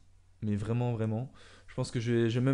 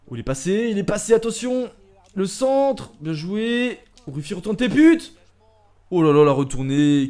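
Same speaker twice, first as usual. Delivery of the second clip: excited shouting; 7 semitones higher, 12 dB louder than usual. Real, not acted. Near-miss chance in a football game.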